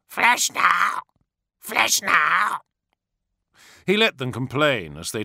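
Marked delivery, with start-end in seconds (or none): 0.0-2.6 high loud squawking crow demand; crow calls. Acted. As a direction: caw it greedily like a crow. Crows complain.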